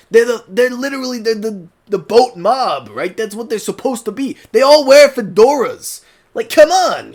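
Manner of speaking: New Yorker gangster. Acted. Fan arguing they're the boat mob, New Yorker gangster accent. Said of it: "kinda New Yorker gangster accents".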